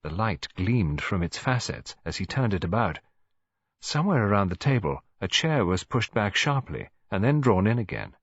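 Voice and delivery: ordinary delivery, nothing marked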